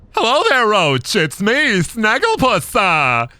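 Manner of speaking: high, lilting cartoon salesman; swooping theatrical phrases with tailing "uh"; Snagglepuss. Snagglepuss selling Roach a pizza, theatrical cartoon voice. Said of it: "wants me to do the Snagglepuss voice".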